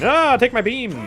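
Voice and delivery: high-pitched playful attack shout; hammed-up attack call; mock battle cry. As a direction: cry out like a game hero firing. Acts out a beam attack.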